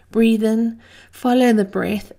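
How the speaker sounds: ordinary talk, nothing marked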